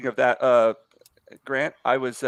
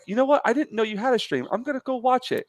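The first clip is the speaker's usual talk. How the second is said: higher, excited friend voice; mock-excited mate gushing about the stream; eager friend; 11 semitones higher than usual. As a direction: chirp it out like a keen new fan. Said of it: "And he's like".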